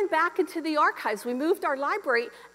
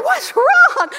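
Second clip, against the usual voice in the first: high, very loud startled question; alarmed shout; 12 semitones higher, 11 dB louder than usual. Retold or imitated. Husband answering his screaming wife, acted alarmed shout. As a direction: imitate a husband yelling in alarm.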